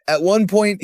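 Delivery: ordinary talk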